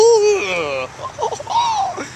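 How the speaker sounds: very high, drawn-out turtle laugh; hammed-up creature laugh. Giant turtle laughing at little people, hammed-up laugh. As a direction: laugh like a huge cartoon turtle.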